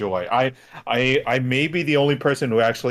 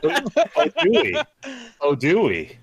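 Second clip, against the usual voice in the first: mock innocence; 10 semitones higher than usual. Feigning innocence about stealing picks.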